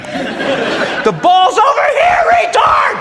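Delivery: slow, high screaming coach-dad; yelling sideline dad. Acted. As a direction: bellow at the kid like an angry dad. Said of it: "I'm not the dad to have at that game".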